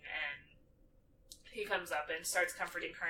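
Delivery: ordinary talk, nothing marked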